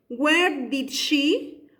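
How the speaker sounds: each word drawn out very slowly, What time did she; slow dictation. Shown as a demonstration. Teacher dictating to students while writing, very slow. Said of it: "pude haber puesto where".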